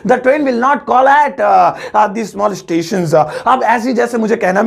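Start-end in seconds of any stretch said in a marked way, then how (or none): 0.1-3.1 slow, deliberate repeat of the model sentence; slow careful English. Shown as a demonstration. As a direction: say it slowly so it sticks. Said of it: "वो stop निकल रहा है".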